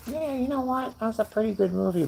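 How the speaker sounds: put-on high-pitched, quick kid voice; son's voice. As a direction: imitate a young teen giving a film opinion. Voices his thirteen-year-old son.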